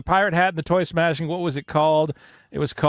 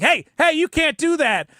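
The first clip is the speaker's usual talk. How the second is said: raised, louder call to stop; mock shout; 10 semitones higher, 3 dB louder than usual. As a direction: play the lady snapping at him to stop. Lady stopping him in line.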